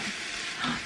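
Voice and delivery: a sharp gasp of remembering; a quick remembering gasp; sudden gasp. Real, not acted. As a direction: gasp as if something just came back to you. Suddenly remembers earring launch.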